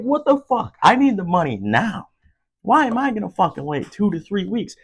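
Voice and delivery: high, heated complaint; exasperated rant. Real, not acted. Complaining to viewers about unemployment pay, exasperated. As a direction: vent the frustration.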